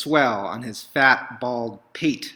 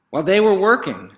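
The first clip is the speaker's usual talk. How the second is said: higher, louder, quick; bright burst; 8 semitones higher, 8 dB louder, faster than usual. A narrator's reading. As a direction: rush into the busy work. Narration burst.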